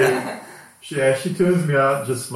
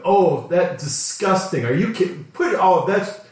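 higher, louder mock disgust; disgusted daughter; 8 semitones higher, 4 dB louder than usual. Daughter reacts to dad taking shirt off, mock disgusted. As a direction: squeal like a grossed-out kid. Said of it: "she just gets into this litany of".

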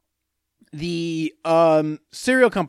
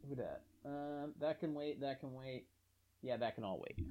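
very quiet, low talk to himself; muttered aside; 4 semitones lower, 22 dB quieter than usual. Real, not acted. Host puts off a task to himself, muttered very low. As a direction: drop right down, as if thinking aloud.

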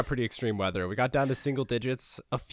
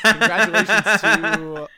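laughing; 8 semitones higher, 8 dB louder, slower than usual. Real, not acted. Laughing while congratulating the winner.

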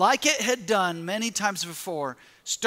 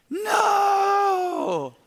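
anguished no; 10 semitones higher than usual. Retold or imitated. Runner crying out as his race falls apart, long despairing wail. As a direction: wail it as the race slips away. Calf cramp ruins marathon.

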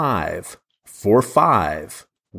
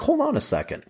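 inner double take; 14 semitones higher, 4 dB quieter than usual. Retold or imitated. And I'm like.

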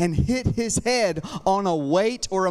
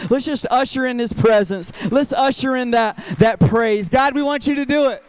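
louder preaching build; rally the room to clap; louder praise-leading call to the congregation; rousing call to praise; 3 semitones higher, 5 dB louder than usual. Real, not acted. Calling for praise and applause.

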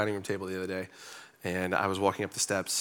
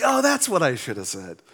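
higher, louder retold dawning realization; mock regretful realization; 7 semitones higher, 5 dB louder than usual. Preacher realizing after reading a good book, mock dismay. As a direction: exclaim it in mock dismay, pitched up.

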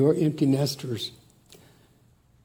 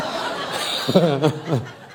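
hearty laugh; 3 semitones higher than usual. Real, not acted. Laughing at his own stressful trips.

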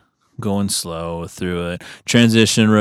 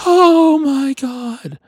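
much higher, slow, strained gasp of shock; 5 dB louder, much slower than usual. Retold or imitated. Hearing the thousand-dollar fine, a hammed-up shocked squeal. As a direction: let the fine hit you in a stretched-out squeal. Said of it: "I was like".

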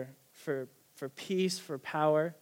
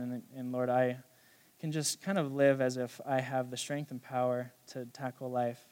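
closing prayer; 3 semitones lower than usual. Acted.

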